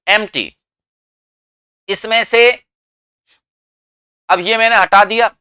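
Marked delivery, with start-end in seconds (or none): 0.1-0.5 "एम्प्टी" as em-tee, no p; correct pronunciation. Shown as a demonstration. Teacher confirming how it is said, p left silent. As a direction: say "एम्प्टी" with m running into t. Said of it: "ऐसे बोलते हैं इसे".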